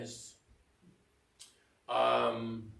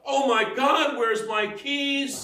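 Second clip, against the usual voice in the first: much higher and louder, frantic; hammed-up alarm, slowing on keys; mock panic; 12 semitones higher, 10 dB louder, slower than usual. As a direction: panic over the lost keys. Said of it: "if you care too much".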